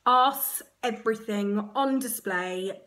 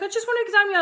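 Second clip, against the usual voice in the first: high, soothing midwife, louder; 11 semitones higher, 7 dB louder, much faster than usual. Retold or imitated. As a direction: gently insist like a midwife. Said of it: "she's like".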